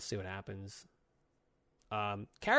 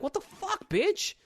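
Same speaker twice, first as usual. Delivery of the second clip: indignant; slower than usual. Retold or imitated. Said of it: "And he's like".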